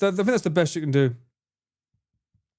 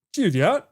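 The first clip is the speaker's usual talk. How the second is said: "Julian" dipping, then rising at the end; 2 semitones higher than usual. Shown as a demonstration. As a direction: swoop down and back up on "Julian". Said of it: "start the pitch going down and up".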